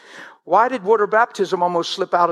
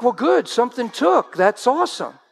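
mock cheerful reply to mother; 6 semitones higher than usual. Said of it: "And I'm like".

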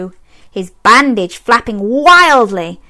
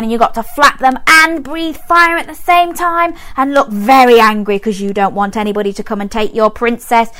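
usual speaking voice

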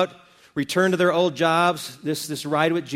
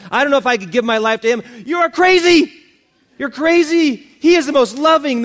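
loud, high-pitched, full-force sermon climax; fervent pulpit exhortation at raised pitch; impassioned preaching; 8 semitones higher, 9 dB louder than usual. Real, not acted. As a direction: preach it with total conviction, punching each phrase.

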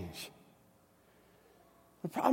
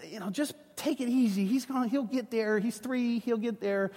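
softer, slightly higher voice of an easygoing wife; wife reassuring; 3 semitones higher, 7 dB quieter than usual. Retold or imitated. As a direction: imitate a wife soothingly playing it down. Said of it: "my wife is still like".

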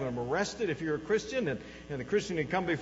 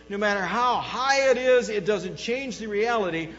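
raised, loud, emphatic declaration; defiant confidence; 5 semitones higher, 7 dB louder than usual. Acted. Believer facing a difficult day, defiantly confident. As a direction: proclaim it with unshakable conviction. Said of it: "the attitude that says".

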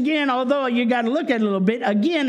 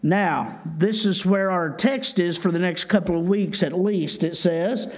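ordinary delivery

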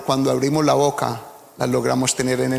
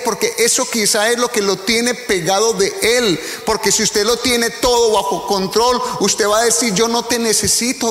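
preaching at full force; 6 semitones higher than usual. Acted. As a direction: preach hard to the congregation. (predicación a todo pulmón, aguda y fuerte)

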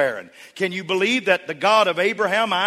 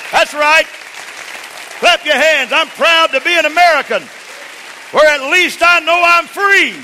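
high, loud rallying shout; full-force preaching; 10 semitones higher, 10 dB louder than usual. Real, not acted. Preacher telling congregation to stand and clap, full-force preaching. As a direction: rouse the whole crowd like a fired-up preacher.

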